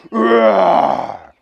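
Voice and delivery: loud mock lion roar; long loud roar. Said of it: "he roared".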